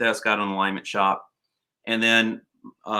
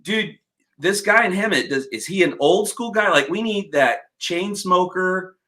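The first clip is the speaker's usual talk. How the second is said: eager plea to buddy; 6 semitones higher than usual. Retold or imitated. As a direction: pitch it excitedly to your friend. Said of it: "and I'm like".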